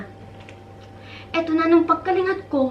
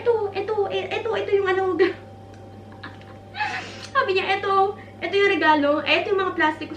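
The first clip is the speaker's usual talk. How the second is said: flustered; 7 semitones higher than usual. Retold or imitated. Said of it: "Taranta siya".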